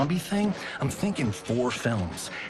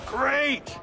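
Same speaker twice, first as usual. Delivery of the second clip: ordinary delivery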